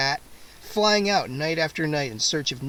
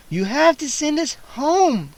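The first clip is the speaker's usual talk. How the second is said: put-on much higher, slow girl's demand; insistent girl; 9 semitones higher, 4 dB louder than usual. A narrator's reading. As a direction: press him firmly. Kara demanding.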